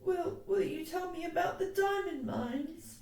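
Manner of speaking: put-on high, halting little-girl request; hopeful child, just done crying; whimpering child. A narrator's reading. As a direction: beg for a story in a small high voice. Lottie whimpering for a story.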